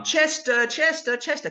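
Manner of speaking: put-on a little boy calling his dog from across the house. Boy from the film calling out for his dog.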